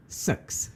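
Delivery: "six" said like "sucks"; Kiwi pronunciation. Shown as a demonstration. Co-host shows how Kiwis say the word, Kiwi pronunciation. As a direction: say "six" the Kiwi way, short dull vowel. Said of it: "I pronounce it like a Kiwi".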